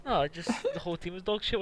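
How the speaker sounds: normal talking voice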